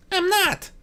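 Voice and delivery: high, playful correction; a high teasing voice pointing out the obvious. A narrator's reading. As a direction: tease him lightly in a high voice. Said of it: "Discord pointed out teasingly".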